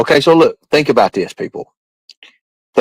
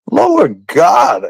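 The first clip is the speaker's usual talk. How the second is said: exasperated shout; 4 semitones higher than usual. Real, not acted. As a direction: cry out in disbelief. Exasperated exclamation.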